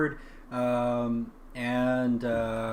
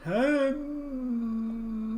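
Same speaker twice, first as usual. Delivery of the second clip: river voice; 9 semitones higher than usual. Acted.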